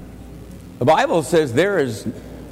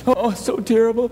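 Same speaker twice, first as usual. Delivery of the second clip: mock lament; 9 semitones higher, faster than usual. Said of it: "and going".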